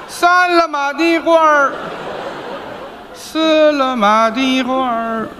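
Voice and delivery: slow drawn-out vendor calls, laughing through; hawker's cry. Acted. Apprentice counts up in a slow hawker's call. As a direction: hawk each line slowly. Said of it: "apprentice answers the call wrongly".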